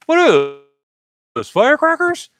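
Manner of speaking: high, loud mock confused friend; puzzled companions. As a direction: play the clueless buddy who doesn't get it. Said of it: "The other guys ... are like".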